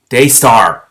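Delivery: loud shocked scolding of a name; mad, horrified call. Acted. Shiar scolding Daystar for offering the sword, loud. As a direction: call him out, half angry half appalled. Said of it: "not sure whether to be mad or horrified".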